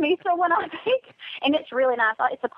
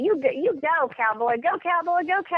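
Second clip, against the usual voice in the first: higher, egging him on like a rodeo crowd; playful cheer; 6 semitones higher than usual. Acted. Cohost cheering her partner to answer first, playful and excited. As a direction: cheer him on, sing-song and teasing.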